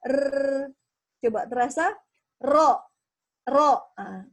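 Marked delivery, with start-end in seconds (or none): 2.4-3.9 "Ro" with a strong tongue-tip trill, louder; "Ro" with a vibrating tongue tip. Shown as a demonstration. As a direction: buzz the r in "Ro". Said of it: "dengan bergetar ujung lidah".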